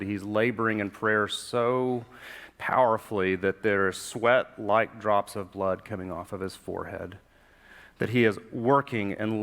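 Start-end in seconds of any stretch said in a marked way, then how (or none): none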